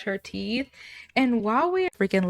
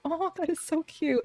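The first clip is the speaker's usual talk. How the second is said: sweet gushing; 7 semitones higher, 8 dB quieter than usual. Acted. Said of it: "And she's like".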